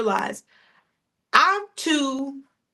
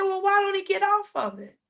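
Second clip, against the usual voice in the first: put-on curious kids; 8 semitones higher than usual. Kids ask the old neighbor.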